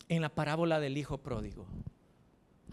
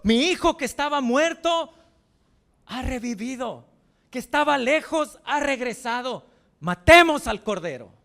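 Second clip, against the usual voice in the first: high, loud overjoyed cry; joyful father; 8 semitones higher, 12 dB louder than usual. Acted. The father rejoicing as his lost son returns, overjoyed shout. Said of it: "el padre se alegra".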